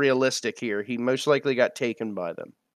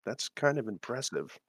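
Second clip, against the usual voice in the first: hushed, much softer confidential murmur; whispering aside; 3 semitones lower, 10 dB quieter than usual. Acted. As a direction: lean in and confide it under your breath. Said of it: "lean in and whisper to Prior".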